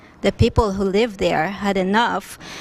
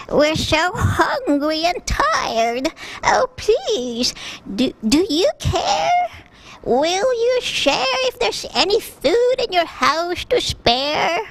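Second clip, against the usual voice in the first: put-on shaking old voice; 10 semitones higher than usual. A narrator's reading. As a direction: plead with a trembling voice, as if about to cry. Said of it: "she said with voice shaking".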